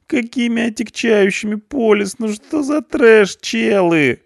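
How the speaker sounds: very high, nasal-free whining youth; a scared punk pleading his case; whiny punk. A narrator's reading. Punk pleads with the policeman, high frantic whine.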